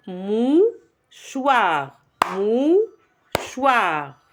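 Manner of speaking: MOUCHOIR split into mou... choir; rising, held "mou", pause, falling "choir" in mouchoir; teacher sounding out mouchoir in two beats; syllable by syllable. Shown as a demonstration. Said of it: "La dernière syllabe".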